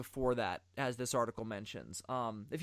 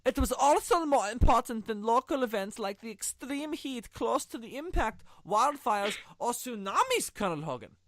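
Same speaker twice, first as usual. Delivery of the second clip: put-on German accent; 9 semitones higher, 4 dB louder than usual. Reading the German scientist's quote to Colonel Hogan in mock German accent. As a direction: ham up a campy German officer reading the quote. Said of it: "I should read this in a German accent".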